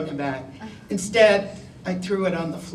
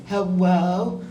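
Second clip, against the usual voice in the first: put-on little boy; 3 semitones higher, 8 dB louder than usual. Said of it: "he's a little boy".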